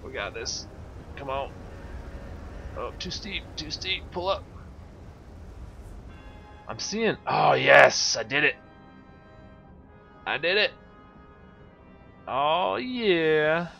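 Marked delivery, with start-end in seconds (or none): 2.8-4.4 rising panic, higher pitch; tense, urgent warning to self; alarmed. Real, not acted. Ship descending too steeply. 7.3-13.7 loud triumphant whoop; excited cheering. Real, not acted. Successful landing in game.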